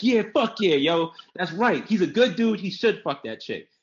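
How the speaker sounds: high, emphatic imitation of hyped-up guys; rally behind him like an excited fan, hammed up; mock cheering guys. Voicing cheering guys on Twitter.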